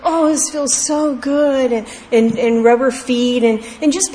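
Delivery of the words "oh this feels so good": put-on slow, higher, contented sigh of an old lady; drawn-out and blissful, like having lotion rubbed on her face; elderly woman sighing with pleasure while being pampered, slow and higher. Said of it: "And she'd go".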